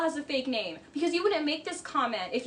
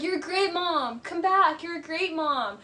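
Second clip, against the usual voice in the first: higher, mock-sweet calling after her; patronizing put-on praise; condescending sweetness; 4 semitones higher, 3 dB louder than usual. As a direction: imitate him fake-complimenting her. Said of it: "Like, how condescending".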